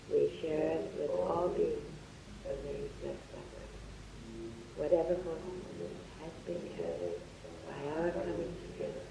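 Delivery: soft, hushed meditation voice; guided meditation and dedication. Acted. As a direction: guide them gently into the light.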